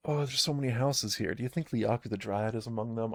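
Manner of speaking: ordinary talk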